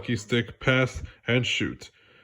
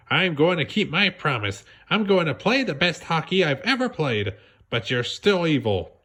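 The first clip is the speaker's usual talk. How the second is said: put-on higher, resolute young hero; determined SpongeBob; 6 semitones higher than usual. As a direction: stand tall and promise.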